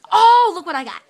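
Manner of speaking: loud, high delighted cry; excited. Real, not acted. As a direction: show it off with glee. Excited about the mermaid tail.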